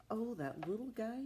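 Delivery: ordinary delivery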